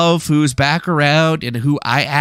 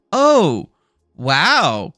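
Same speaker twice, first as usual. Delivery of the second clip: mock shock. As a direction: fake outrage, way over the top. Fake shocked at scandal.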